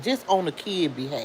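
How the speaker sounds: ordinary talk, nothing marked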